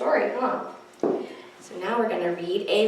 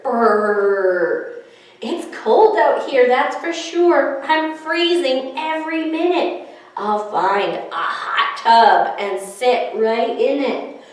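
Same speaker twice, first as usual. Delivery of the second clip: louder, shivery brr; shivering snowman; 4 dB louder than usual. Acted. Snowman Sneezy complaining to the children, shivering. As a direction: shiver through the words. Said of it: "Sneezy the snowman shivered".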